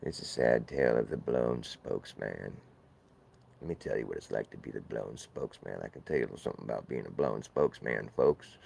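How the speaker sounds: low woeful telling of the blown spokesman; sad storyteller. Acted. Low voice ends the tale.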